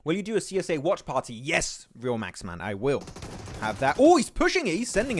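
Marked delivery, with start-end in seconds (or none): 4.0-5.2 much higher and louder, caught off guard; sudden commentator excitement; excited outburst. Real, not acted. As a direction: blurt it out as the play surprises you. Sudden aggressive push in match.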